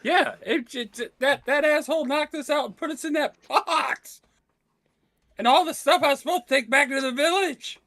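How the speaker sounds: high, worked-up character complaint; outraged rant. Acted. Robbed traveler complains about being boxed up, outraged. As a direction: rant in a high, strained acted voice.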